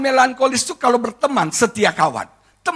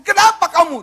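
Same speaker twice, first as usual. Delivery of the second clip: retold annoyed husband; 7 semitones higher than usual. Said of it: "Saya marah dong".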